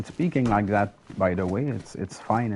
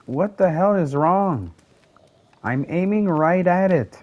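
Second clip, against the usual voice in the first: frustrated outburst; 7 semitones higher, 6 dB louder than usual. Real, not acted. Missing shots in game.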